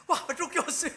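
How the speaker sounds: loud, high, laughing cry of relief; overjoyed shout. Retold or imitated. A preacher reliving spotting the petrol station, joyful laughing shout. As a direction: burst out laughing with joy at the sight. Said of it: "I was so happy".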